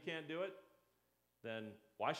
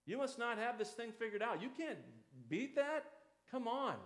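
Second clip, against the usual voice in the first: higher, scolding mock disbelief; judgmental churchgoer; 6 semitones higher than usual. Congregation member scolding a struggling sinner, higher mock scorn. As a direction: play the finger-wagging saint. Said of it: "They'd go".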